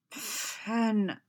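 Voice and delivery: exasperated groan of a name. A narrator's reading. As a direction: groan it out in protest. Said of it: "Boba groaned".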